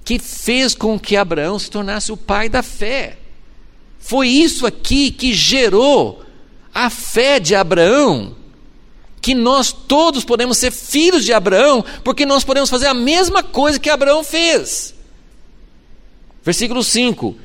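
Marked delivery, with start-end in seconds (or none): 4.0-14.9 preaching. Real, not acted. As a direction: build to the climax of the sermon. Preaching climax. (pregação enfática e crescente)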